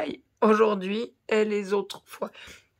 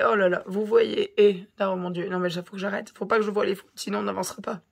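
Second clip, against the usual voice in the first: ordinary delivery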